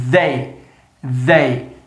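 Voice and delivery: "they" with a buzzing voiced th, tongue on the teeth; TH demo. Shown as a demonstration. Teacher showing students the voiced th, buzzing like Z. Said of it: "Então, ó".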